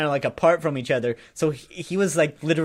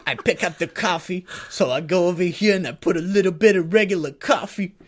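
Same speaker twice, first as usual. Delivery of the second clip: raised, lively retelling of his coffee routine; imitated excitement; 4 semitones higher, 4 dB louder than usual. Homeless guy showing how he mixes coffee, imitated excitedly. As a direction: play him proudly listing each step. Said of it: "so excited about it".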